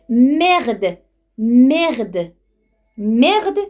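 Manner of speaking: loud, punchy swearing; exclaimed curse. Shown as a demonstration. Demonstrates a French swear word exclaimed.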